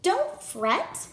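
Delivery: a touch of a small squeaky mouse, soothing; storyteller hints at a squeaky little mouse. A narrator's reading. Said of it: "Mouse squeaked".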